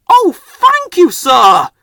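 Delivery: very high, loud delighted thanks; proud Harvey. A narrator's reading. Crane engine thanking his boss, very high and loud. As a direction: burst out gratefully. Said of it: "said Harvey proudly".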